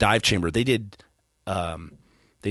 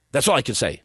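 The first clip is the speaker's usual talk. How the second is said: normal talking voice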